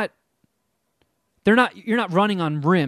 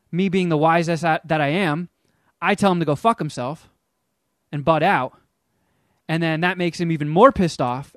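normal talking voice

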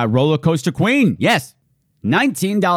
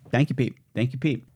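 usual speaking voice

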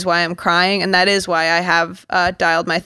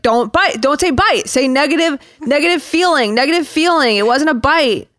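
hammed-up excuses, rising pitch; defensive shark; 5 semitones higher than usual. Shark defends itself to the guests, hammed-up pleading. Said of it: "And then he's like".

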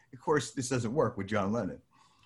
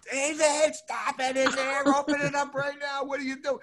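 high shrill-pitched mock mother; mother scolding; 14 semitones higher than usual. Mother demanding he open the door, high scolding. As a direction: shout as the angry mother up high. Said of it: "my mother goes".

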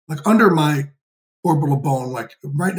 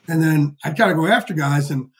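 usual speaking voice

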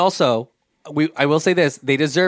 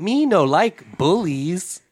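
put-on slow babyish caveman voice; dopey cartoon bellow; 3 semitones higher, much slower than usual. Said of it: "He acts like a baby".

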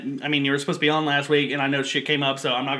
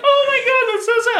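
very high, mock sympathetic squeal; mock pitying girlfriend; 3 dB louder than usual. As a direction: gush it in a shrill mock pity. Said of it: "she's like".

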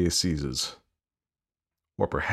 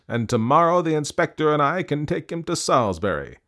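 a hint of a satisfied constable, higher; lightly voiced constable; 6 semitones higher than usual. A narrator's reading. Constable shows the sack to the lady, mildly satisfied. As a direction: constable pleased with the evidence. Constable triumphant over evidence.

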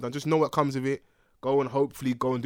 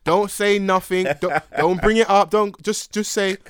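louder, slow, laughing warning; slow mock warning; 3 semitones higher, 6 dB louder, slower than usual. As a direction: warn them slowly, half laughing. Mock warning audience about beard.

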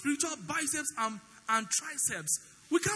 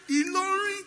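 louder plea; 8 semitones higher, 7 dB louder than usual. Retold or imitated.

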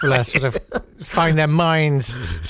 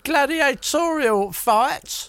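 higher, slow gossip-tale voice; mock storyteller; 9 semitones higher, slower than usual. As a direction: spin it like a hammed-up pub yarn. Mock tale told to friend.

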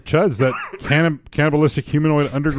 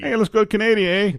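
high, put-on Canadian accent; comic Canadian with a tagged-on eh; 7 semitones higher, faster than usual. Inviting cohost up to Canada, in mock Canadian accent. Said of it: "you're not using the accent".

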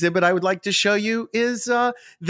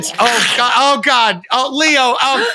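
panicked; 5 semitones higher, 4 dB louder than usual. Acted. Lion attacks him, panicked cries.

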